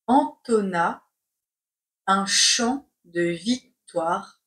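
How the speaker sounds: slow, chunked with a pause; slow dictation. Shown as a demonstration. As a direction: dictate word by word. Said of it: "C'est parti pour la dictée".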